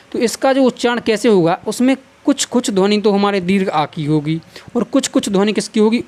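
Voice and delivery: ordinary talk, nothing marked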